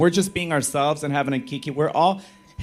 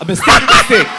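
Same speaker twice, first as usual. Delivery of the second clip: laughing through the name; 4 semitones higher, 9 dB louder, faster than usual. Real, not acted. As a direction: giggle the name out. Laughing at own slip.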